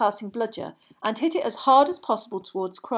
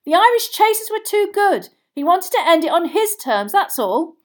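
high, loud reasoning; shouting back; 8 semitones higher, 7 dB louder than usual. A narrator's reading. Boy defends the seeker over the noise, shouting. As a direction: argue it over the crowd's roar. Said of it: "Harry shouted back over all the noise".

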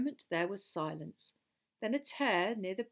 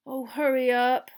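loud, higher-pitched moan; impatient moan; 5 semitones higher, 8 dB louder than usual. A narrator's reading. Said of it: "Ron moaned beside Harry".

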